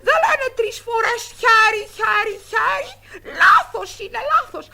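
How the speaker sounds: put-on child yelling that the other cheated; furious, drawn-out accusing shout; angry shout. Child shouting at friend for cheating, angry.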